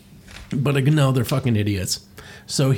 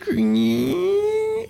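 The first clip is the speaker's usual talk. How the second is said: long, very high imitated creak; slow door creak. Boo slowly opens the door, high drawn-out creak. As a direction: draw it out like an opening door. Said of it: "decides to open up the door slowly".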